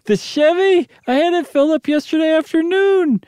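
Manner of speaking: high, puzzled wife's voice; dazed wife. Acted. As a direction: answer high and confused. Janet's voice in the story.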